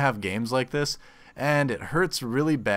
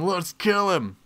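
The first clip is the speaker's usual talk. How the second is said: eager mock war cry; 7 semitones higher, 4 dB louder, much slower than usual. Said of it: "But my hands are saying".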